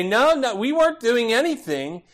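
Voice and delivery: lightly acted protest of innocence; children's mock denial. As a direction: imitate kids lying to a parent. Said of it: "when they lie to you and say".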